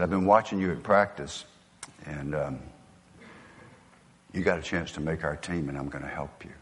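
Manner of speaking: low and soft, retelling a private offer; quiet mentor. Retold or imitated. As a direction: lightly imitate a mentor quietly offering help. Retold mentor offering help.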